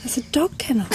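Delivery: "kennel" whispered clearly after "dog"; whispered correction. Shown as a demonstration. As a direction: whisper "That's a dog kennel" as a gentle fix. Parent corrects toddler softly.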